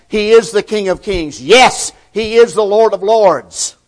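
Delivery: loud, driving preacher's cadence; full-force preaching. Acted. Preacher to his congregation, booming sermon delivery.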